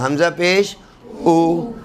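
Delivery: hamza pesh as a long, slow "او" with a pure oo sound. Shown as a demonstration. Quran teacher demonstrates hamza with pesh, pure long oo. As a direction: draw "او" out as oo, never o. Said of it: "حمزہ پیش او کبھی بھی نہیں ہوگا".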